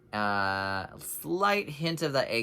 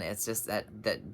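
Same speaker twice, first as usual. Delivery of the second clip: normal talking voice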